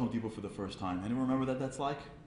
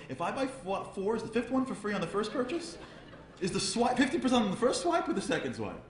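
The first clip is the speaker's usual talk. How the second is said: haggling customer; 10 semitones higher than usual. Retold or imitated. Frum guy haggling in aisle.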